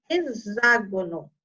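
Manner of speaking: "hexágono" with a clear Z for X. Shown as a demonstration. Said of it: "é o som do Z".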